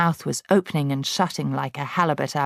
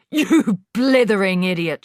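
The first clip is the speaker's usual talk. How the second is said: loud, higher insult; shouting; 4 semitones higher, 6 dB louder than usual. A narrator's reading. Headmistress shouting insults at Wilfred, loud. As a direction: bellow it at the boy. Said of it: "shouted the Trunchbull".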